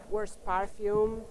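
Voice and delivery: ordinary talk, nothing marked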